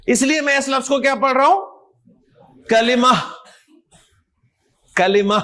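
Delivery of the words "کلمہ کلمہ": کلمہ ending in H, not T; final H sound. Shown as a demonstration. Teacher showing how he reads the word, کلمہ without final T. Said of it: "کلمت تو نہیں کہہ رہا نا".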